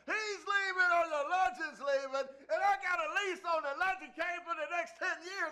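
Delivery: mock shout. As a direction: bellow it at the coach for the show. Threatening the coach on air.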